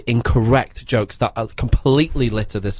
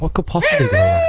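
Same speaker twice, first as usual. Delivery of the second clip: high mock voice. Echoing line in a jingle voice.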